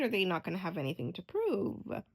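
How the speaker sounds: normal talking voice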